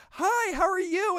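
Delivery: very high imitated woman's voice; mock starstruck greeting. Imitating an actress meeting her idol, mock starstruck greeting. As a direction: jump way up in pitch, playing the fan. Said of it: "and she's like".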